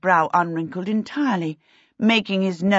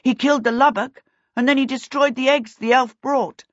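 raised, higher, emphatic voice; shouting through noise; 7 semitones higher, 4 dB louder than usual. A narrator's reading. Peter tells cheering kobolds the news, calling over the noise. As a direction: project over a noisy crowd. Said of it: "Peter shouted through the noise".

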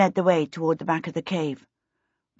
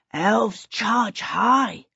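slow, drawn-out awe, pitched up; very impressed; 4 semitones higher, much slower than usual. A narrator's reading. Kobold by Charmaine's knee remarks on elves, slowly and impressed. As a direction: stretch each word as if deeply impressed. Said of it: "sounding very impressed".

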